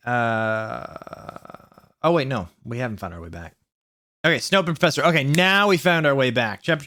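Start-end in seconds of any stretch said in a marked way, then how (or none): 2.6-3.6 low, soft let-down; deflated. Real, not acted. Reader realising the place is still wrong, deflated. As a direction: drop it low and quiet, defeated.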